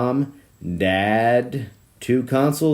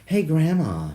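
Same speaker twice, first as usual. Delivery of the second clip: attempted male voice; 8 semitones higher than usual. Greeting Grandma in a failed male voice, kid-like. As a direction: try a grown man's greeting, end up sounding like a child. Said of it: "I'm trying to do a male voice".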